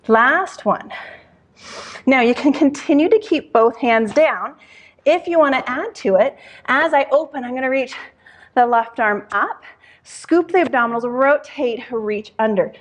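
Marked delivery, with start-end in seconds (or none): none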